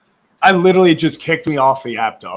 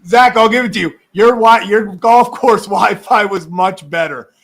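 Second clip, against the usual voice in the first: laughing while talking, higher and louder; 5 semitones higher, 6 dB louder than usual. Real, not acted. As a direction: tease through bursts of laughter. Laughing teasing about the Wi-Fi.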